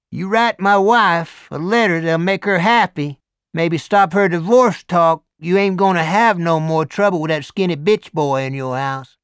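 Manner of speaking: big Southern biker voice; Deep South accent. A narrator's reading. Hell's Angel asking Morris, loud Deep South accent. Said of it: "Deep South accent".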